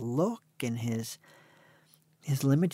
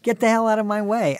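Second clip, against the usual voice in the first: loud, high mock tirade; comic rude yell, pitched high; rude demand; 8 semitones higher, 8 dB louder than usual. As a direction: push them aside with a raised voice. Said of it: "That's how I say to old people now".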